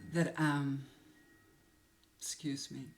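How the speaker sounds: halting, real emotion stopping the words; overcome, voice dropping away; choked up. Real, not acted. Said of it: "getting all emotional".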